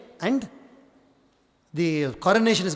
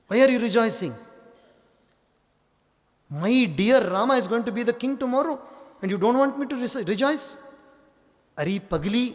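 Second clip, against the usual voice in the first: higher, scornful scolding maid; mocking Manthara; 4 semitones higher than usual. Manthara mocks Kaikeyi's joy in a higher, scornful voice. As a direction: needle her as the scheming maid.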